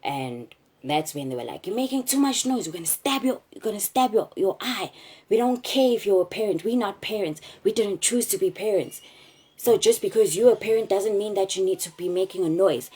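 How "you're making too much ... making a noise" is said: much higher, louder threatening shouts; retold furious yelling. Kidnappers threatening to stab her eye, furious retold yelling. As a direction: rant at her to shut up.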